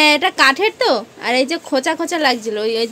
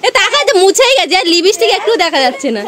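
half shouting, half laughing at her; loud scolding; 9 semitones higher, 7 dB louder than usual. Real, not acted. Scolding crying little sister. (বকাঝকা করা চড়া গলা)

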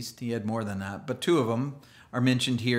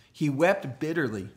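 normal talking voice

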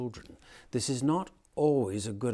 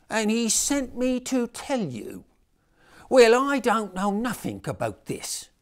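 put-on much higher, louder child voices; children's nativity voices; 9 semitones higher, 8 dB louder, slower than usual. A narrator's reading. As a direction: play the squabbling Mary and angel.

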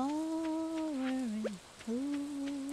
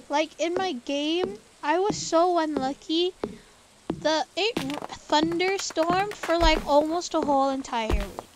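louder, higher aggrieved rant; indignant complaint; 5 semitones higher, 11 dB louder than usual. Real, not acted. Player complaining to viewers about a week of storms, loud and aggrieved. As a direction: vent about your rotten luck.

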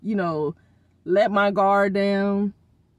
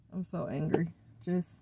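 quiet anger; 5 semitones lower, 10 dB quieter than usual. Real, not acted.

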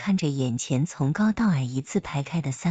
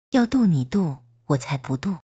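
usual speaking voice

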